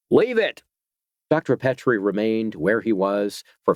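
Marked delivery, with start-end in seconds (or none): 0.1-0.6 shouted order. A narrator's reading. Harry shouts at the doctor to leave the rifle. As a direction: snap the command out.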